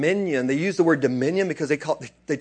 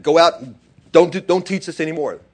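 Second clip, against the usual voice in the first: stern order; 3 semitones higher, 9 dB louder than usual. Retold or imitated. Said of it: "they beat them and said".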